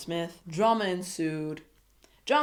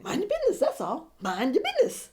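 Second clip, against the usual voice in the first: much higher, sing-song scolding call; playful scold; 9 semitones higher, faster than usual. Pretend. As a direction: toss it off like a teasing warning. Repeated scolding catchphrase, sung out.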